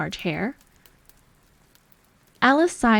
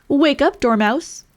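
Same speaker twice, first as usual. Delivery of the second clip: calling out; 6 semitones higher, 5 dB louder than usual. A narrator's reading. Pinching the sleeping Dormouse.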